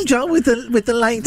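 high squeaky Joe voice; high Joe impression. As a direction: pitch up to mimic Joe. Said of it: "That's better".

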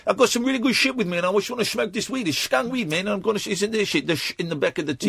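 high-pitched mock Dutch stoner accent; hammed-up chompy Dutch accent; crazy Dutch accent. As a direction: ramble as a Dutch caricature, chewing words in the back teeth. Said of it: "I'm a crazy Dutch guy".

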